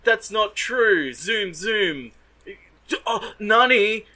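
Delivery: high, loud hammed-up anime protest; mock angry outburst. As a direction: overact a shocked, angry anime boy. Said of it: "the protagonist gets angry and is like".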